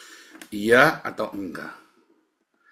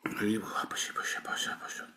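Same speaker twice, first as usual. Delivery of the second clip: whisper; 9 dB quieter, faster than usual. Retold or imitated. Mocking gossipers' whispering.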